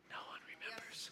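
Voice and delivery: barely audible mock stage whisper; whispered aside. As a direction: whisper it under the main talk as an aside. Whispered aside on silent congregation.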